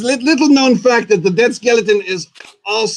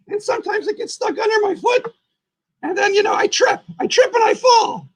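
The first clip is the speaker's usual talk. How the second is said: very high, chatty creature voice; squeaky puppet Boogeyman. Acted. Boogeyman explaining how he trips on his nose, very high voice.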